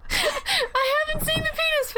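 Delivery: high squealing laugh through words; laughing shriek. Real, not acted. Shrieking laugh at penis fish.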